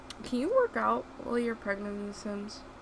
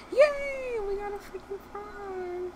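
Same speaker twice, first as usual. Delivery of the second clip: excited squeal; 11 semitones higher than usual. Real, not acted. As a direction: squeal with real joy. Thrilled at finding a frog.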